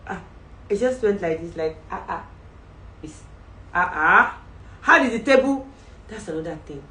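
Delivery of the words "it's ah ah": usual speaking voice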